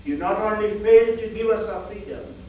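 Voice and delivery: ordinary talk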